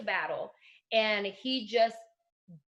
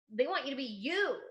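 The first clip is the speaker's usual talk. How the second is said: ordinary talk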